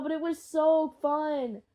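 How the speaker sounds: normal talking voice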